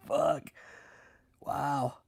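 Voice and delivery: ordinary talk, nothing marked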